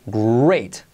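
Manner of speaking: "great" alone, stretched out for repetition; palabra aislada. Shown as a demonstration. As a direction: model "great" slowly with a long r. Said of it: "Repite conmigo". ("great" lento y aislado, para repetir)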